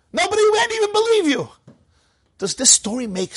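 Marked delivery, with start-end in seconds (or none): 0.1-1.5 much higher, a scoffing put-on voice; mock incredulous voice. Rabbi voicing a scornful retort, high and mocking. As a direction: scoff as if no one would buy it.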